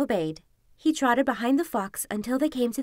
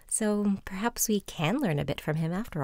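soft whispered close; whispering; 2 semitones lower, 4 dB quieter than usual. Real, not acted. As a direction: whisper it gently. Whispered closing.

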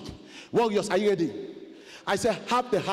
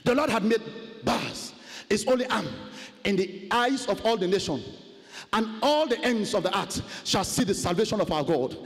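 usual speaking voice

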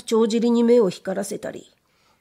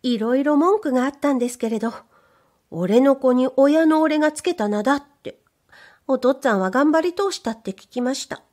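higher, bashful maid's voice; shy young maid; 5 semitones higher than usual. Acted. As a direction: play the timid young servant girl. Maid continues.

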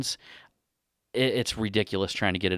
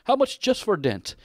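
higher, quizzical Indian accent impression; imitated Indian accent; 11 semitones higher than usual. Customer asking about his bumper, Indian accent impression. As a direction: ask the price in a put-on Indian accent. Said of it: "just based on the accent there".

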